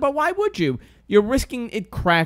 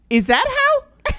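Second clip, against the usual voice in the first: slow, high, sing-song "is that how"; high, drawn-out mock question; 5 dB louder, slower than usual. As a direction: stretch it out like a baffled lady. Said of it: "Turns to somebody else and goes".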